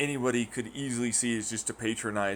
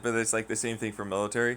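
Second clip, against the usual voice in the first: usual speaking voice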